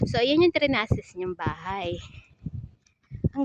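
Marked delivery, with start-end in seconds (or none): none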